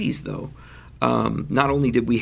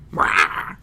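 short growly animal "rah"; badger sound. Shown as a demonstration. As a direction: bark out a badger noise. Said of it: "that's my badger sound".

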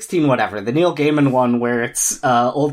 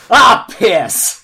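loud, higher, hammed-up American accent; bad American accent; 8 semitones higher, 9 dB louder than usual. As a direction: swear in a badly faked American accent. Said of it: "in his bad American accent".

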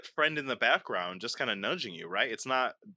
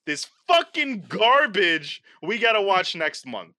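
mock fury; 6 semitones higher, 4 dB louder, slower than usual. Mock rage continues.

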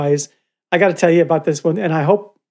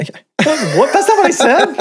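high, incredulous protest; mock indignation; 10 semitones higher than usual. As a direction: deny it in comic disbelief. Acted denial of a garbled rumor.

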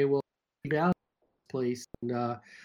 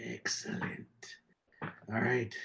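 sinister whisper; 4 semitones lower, 9 dB quieter, slower than usual. Pretend. Villainous response, very low.

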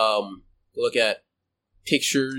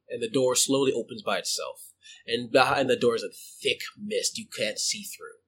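ordinary talk

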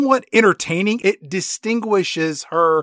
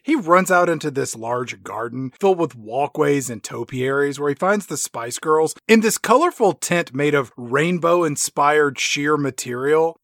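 usual speaking voice

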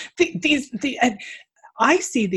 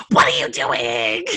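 mock-outraged low holler; crazed, low bellow played for laughs; crazy-person outburst; 7 semitones lower, 4 dB louder than usual. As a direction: re-enact losing it at a child, in a put-on low voice. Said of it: "I lost my mind".

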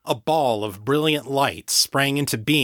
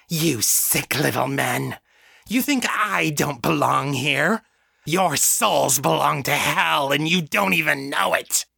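sneered; 4 semitones higher than usual. A narrator's reading. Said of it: "Janet sneered".

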